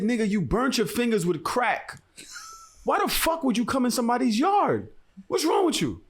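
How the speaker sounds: high-pitched, exasperated telling-off; retold angry scolding. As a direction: re-enact chewing out an intruder. Acted confrontation with intruder in yard.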